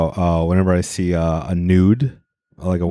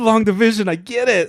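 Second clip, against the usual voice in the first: much higher, giddy mock discovery; mock epiphany; 13 semitones higher than usual. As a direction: gush like a kid amazed by long division. Mocking the high kid's epiphany.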